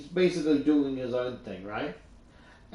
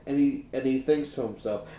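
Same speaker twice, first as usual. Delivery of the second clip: ordinary delivery, nothing marked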